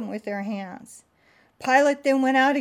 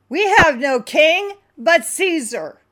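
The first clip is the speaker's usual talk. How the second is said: loud, higher declaration; priests declaring; 7 semitones higher, 10 dB louder than usual. Acted. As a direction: proclaim it defiantly. Chief priests answering Pilate.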